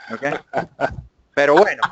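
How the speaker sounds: normal talking voice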